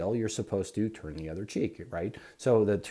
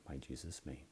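much softer, lower, under the breath; murmured prayer; 7 semitones lower, 19 dB quieter than usual. Pretend. Answering an insult with a prayer, murmured very softly. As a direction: pray it inwardly to yourself.

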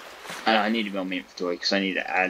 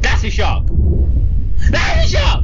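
much higher, shouted alarm; panicked shout; 12 dB louder than usual. Real, not acted. Gamer spotting a shark, panicked shout. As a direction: yell it out in fright.

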